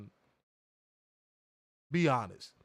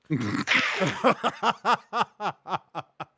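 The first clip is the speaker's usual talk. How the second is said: long rolling laugh; real extended laughter; 3 semitones higher, 6 dB louder than usual. Real, not acted. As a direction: laugh hard at the punchline. Laughing at the story.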